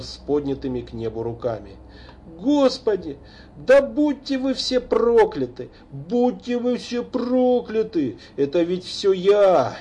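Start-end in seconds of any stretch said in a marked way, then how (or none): 2.4-9.8 anguished cursing. A narrator's reading. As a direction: curse at the sky. Kneeling father curses to heaven. (отчаянное проклятие небу)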